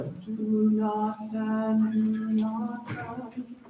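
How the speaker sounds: open presence. Shown as a demonstration. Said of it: "a little more intentional".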